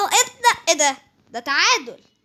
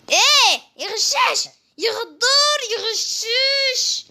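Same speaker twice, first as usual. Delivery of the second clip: high, slow mocking sing-song; drawn-out taunt; 6 semitones higher, slower than usual. Taunting Jiffy as a cheater in a drawn-out tease. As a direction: stretch out the insult teasingly.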